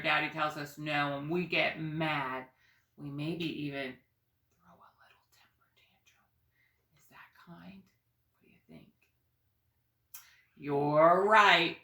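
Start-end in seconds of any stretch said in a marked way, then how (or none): 4.6-8.9 whisper. Real, not acted. Teacher whispers a question to kids.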